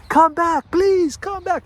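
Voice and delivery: the lazy self begs you to come back; pleading old self. Acted. The old self chases the train, pleading high. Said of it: "is running and is coming".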